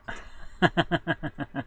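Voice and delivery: long chuckle to himself; real delighted laughter; amused laugh. Real, not acted. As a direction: laugh in a run of short bursts. Amused by the word's spelling.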